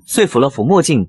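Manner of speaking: ordinary delivery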